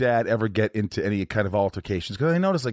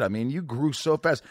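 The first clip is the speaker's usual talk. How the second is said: normal talking voice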